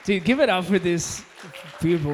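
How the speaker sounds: high, loud shout-out to the crowd; rally the room to clap loudly; calling for applause. Real, not acted. Host calls for applause.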